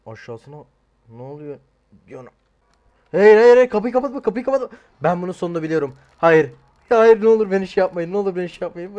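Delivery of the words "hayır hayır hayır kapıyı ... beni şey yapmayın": high, loud hammed-up begging; mock panicked pleading. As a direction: beg them not to close the door. Pleads with game characters.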